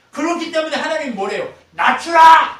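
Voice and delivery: high, forceful shout building to a peak; full-voiced pulpit shout; thundering command. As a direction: preach it out as God's loud command. Preacher thunders God's command.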